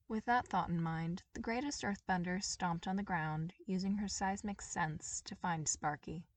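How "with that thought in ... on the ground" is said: usual speaking voice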